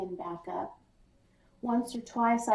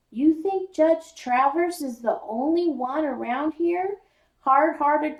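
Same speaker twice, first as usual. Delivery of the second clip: raised higher and louder; sharp; 7 semitones higher, 6 dB louder than usual. Acted. Father scolding his son about cruel dog owners, sharp and loud. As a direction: lecture him hard and loud. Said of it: "Dad's voice is sharp".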